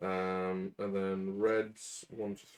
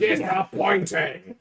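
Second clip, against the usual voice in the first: loud exclamation; 8 semitones higher, 6 dB louder, slower than usual. Real, not acted. Mock outrage in banter.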